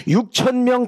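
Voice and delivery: 육천 명 flung out high and loud; excited emphasis. Acted. Pastor rallying the congregation with big excited emphasis. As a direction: throw the goal out with a burst of zeal. Pastor names his goal.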